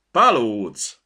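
loud, proud one-word declaration; proud market woman speaking up loudly. A narrator's reading. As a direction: announce it with swelling pride. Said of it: "A néni kihúzta magát".

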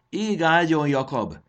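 high, loud farewell cry; shouted farewell. A narrator's reading. Crowd bids Jakab farewell as he flies off, shouting. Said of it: "kiáltották a strapák".

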